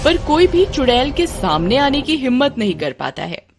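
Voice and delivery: ordinary delivery, nothing marked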